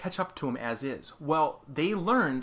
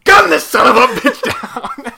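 loud, higher, gleeful shout through laughter; laughing gun order; 10 semitones higher, 16 dB louder than usual. Retold or imitated. Pirate crew laughing as they order the kill, shouting. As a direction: bark it like a crew cackling before opening fire. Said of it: "they're just laughing, like".

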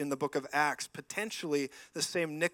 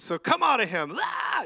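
loud burst of command ending in a yell; exorcism command; 6 semitones higher, 7 dB louder than usual. Retold or imitated. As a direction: shout the demon out. Retelling his command to a demon.

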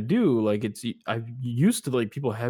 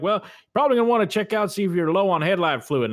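much higher, louder, fast imitation of an old Southern gentleman; older Southern gentleman; 9 semitones higher, 6 dB louder than usual. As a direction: ham up a folksy old Southern man giving advice. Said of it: "he's an older Southern gentleman".

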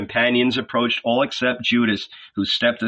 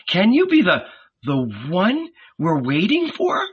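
trembling voice; 6 semitones higher than usual. Acted. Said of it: "slowly and in a trembling voice".

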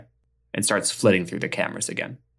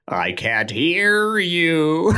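high, slow, drawn-out shout; mock drill sergeant; 8 semitones higher, much slower than usual. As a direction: stretch it out like a pirate captain. Mock captain reply.

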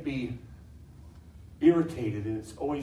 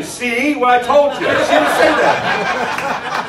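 laughing wife quote; 11 semitones higher, 15 dB louder, much slower than usual. Retold or imitated. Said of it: "his wife would say".

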